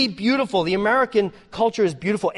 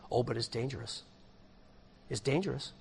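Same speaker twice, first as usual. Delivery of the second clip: low, ominous warning, softer; a hammed-up foreboding voice; mock warning; 9 semitones lower, 13 dB quieter than usual. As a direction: drop low like a spooky caution.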